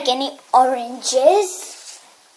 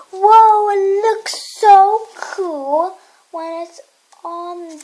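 amazed; 5 semitones higher, slower than usual. Real, not acted. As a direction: gasp at how cool it looks. Kid amazed at sparkly toy.

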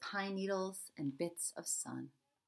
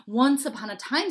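high, loud story opening; eager storyteller; 7 semitones higher, 11 dB louder than usual. A narrator's reading. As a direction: launch the tale with gusto. Charlie begins telling his story.

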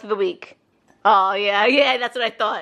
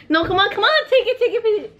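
high giggly pleading; 12 semitones higher, faster than usual. Real, not acted. Wife pleads him take cup.

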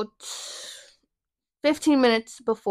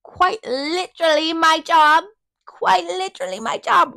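indignant sass; 7 semitones higher, 4 dB louder than usual. Retold or imitated. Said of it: "What do you mean I shouldn't be here".

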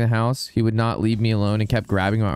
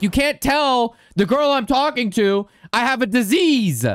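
retold outrage; 11 semitones higher than usual. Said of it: "I was like".

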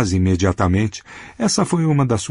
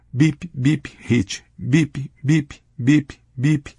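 higher-pitched mock boy's beeping; pinched-nose boy voice; 4 semitones higher, much slower than usual. A narrator's reading. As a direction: beep like a kid holding his nose, without really shouting. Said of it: "tapou o nariz e gritou em uma voz trêmula de garoto". (voz de garoto com nariz tapado)

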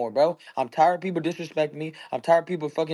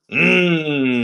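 loud burst of laughter; 7 dB louder than usual. Real, not acted. Laughs after vengeance boast.

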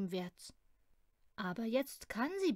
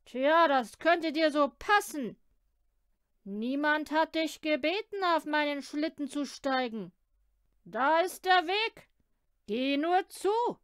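loud, mocking, higher man's voice; gruff sleigh driver; 5 semitones higher, 9 dB louder than usual. Driver mockingly turns the girl away, loud and gruff. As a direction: bark at her and wave her off.

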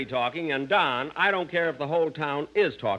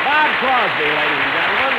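loud, higher introduction; announcing loudly; 4 semitones higher, 9 dB louder than usual. Real, not acted. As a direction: call out the guest's name. Introducing guest to audience.

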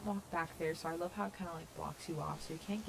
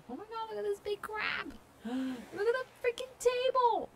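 very high, loud squealing; excited squeal; 14 semitones higher, 7 dB louder than usual. Real, not acted. Gamer spotting a big crab and table, squealing. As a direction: shriek with delight, stretching the last words. Thrilled by items on island.

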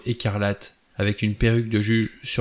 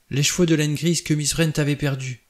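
higher, horror-struck murmur; raised pitch, quivering with dread; trembling with horror; 5 semitones higher than usual. A narrator's reading. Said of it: "d'une voix frémissante d'horreur".

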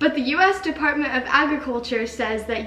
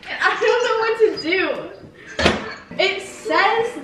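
higher, laughing through the words; helpless laugh; 7 semitones higher than usual. Real, not acted. Telling her brother she's stuck, laughing helplessly and higher.

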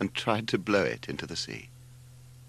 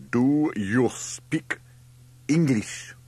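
put-on loud, very slow, foreign-accented demand; word by word, higher and menacing; ominous; 7 semitones higher, 6 dB louder, much slower than usual. A narrator's reading. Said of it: "he said ominously".

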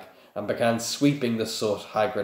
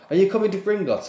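eager; 11 semitones higher, 6 dB louder, faster than usual. Acted. Hermione eager question.

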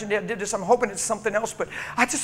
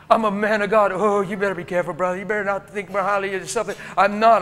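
fervent preaching; 6 dB louder, faster than usual. Acted. Preaching at full force.

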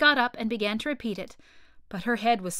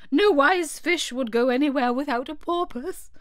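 higher, louder turtle voice; Mock Turtle voice; 5 semitones higher, 6 dB louder than usual. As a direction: declare it like a pompous turtle.

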